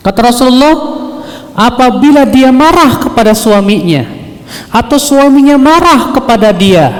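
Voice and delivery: high-pitched, emphatic proclamation; forceful preaching. Acted. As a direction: drive the hadith home to the congregation. Preaches the hadith at full force. (ceramah berapi-api dengan nada tinggi)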